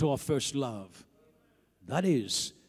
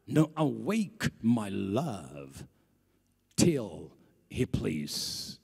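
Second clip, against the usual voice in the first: scripture reading; 5 dB louder than usual. Acted. Preacher reading the Song of Songs to church, solemn. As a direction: read the verse with hushed weight.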